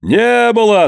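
high jeering cry; crowd shout. A narrator's reading. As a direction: throw the denial back mockingly. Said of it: "закричали в толпе".

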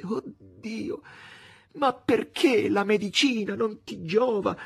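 high, slow tearful lament; anguished, tearful murmur. A narrator's reading. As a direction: plead in anguish, pitched high. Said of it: "angosciata e piangente".

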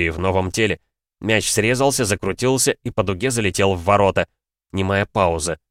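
usual speaking voice

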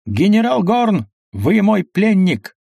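high, drawn-out triumphant call; heated colonel. A narrator's reading. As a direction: call out slowly and high, claiming a prisoner. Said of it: "подскакал разгоряченный полковник Рен". (разгорячённо, высоко и протяжно)

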